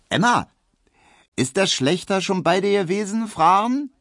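high, loud calling voice; girl calling after. A narrator's reading. Girl calls after Emma about the butcher, high and loud. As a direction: shout the question after her. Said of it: "rief ein Mädchen".

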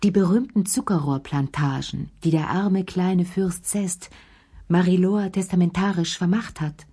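ordinary delivery

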